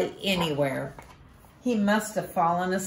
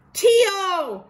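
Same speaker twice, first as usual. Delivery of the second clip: high, loud shout; boy Ko shouting at Tio in anger; 7 dB louder than usual. Acted. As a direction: yell his name across the room. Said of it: "Ko shouted".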